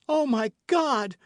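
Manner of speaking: high, loud, slow terrified cry. A narrator's reading. Milady crying out as she is carried to the boat. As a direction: cry out to God in terror. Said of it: "cried she".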